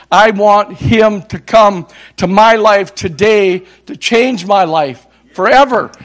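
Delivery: loud, rising preacher's build; preaching at full force. Acted. Preacher driving the congregation toward a loud climax. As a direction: preach it harder with every line.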